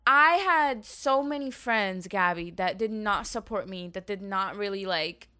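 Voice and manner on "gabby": ordinary delivery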